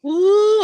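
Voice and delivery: loud high ooh. Real, not acted. Reacting to the story.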